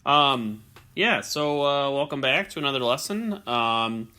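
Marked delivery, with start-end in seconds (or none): none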